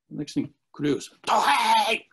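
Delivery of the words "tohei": loud, high call of "Tohei!" across the dojo; yelling a name. Retold or imitated. Young student hunting the teacher down, yelling "Tohei!". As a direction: bellow "Tohei!" to find him. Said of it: "He goes yelling".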